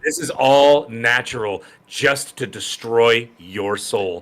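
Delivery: low, slow menacing drawl; a co-host playing the soul-stealing villain, slow and low; mock villain. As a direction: drag it out like a cartoon villain. Mock villain taunt on stream.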